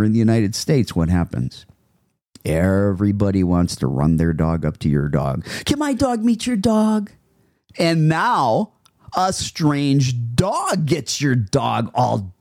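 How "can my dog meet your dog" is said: high-pitched put-on asking voice; mock-chirpy owner's request; eager dog owner. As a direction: mimic an overeager stranger with a dog. Mocking owners who approach dogs.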